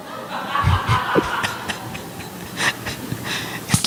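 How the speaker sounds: long wheezy, soundless laugh; helpless laugh, mostly air. Real, not acted. Laughs at his own joke.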